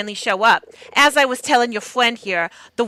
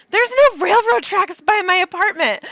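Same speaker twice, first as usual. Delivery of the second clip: much higher, louder retold disbelief; mock disbelief; 11 semitones higher, 5 dB louder than usual. Retelling a reaction to the train in a high, loud voice. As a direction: squeak it out in disbelief. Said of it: "I was like".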